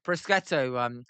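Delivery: usual speaking voice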